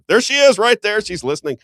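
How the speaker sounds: high, loud burst of surprise; excited calling out. Real, not acted. Host spots his wife listening in, excited calling out. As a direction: call her out, voice jumping up high.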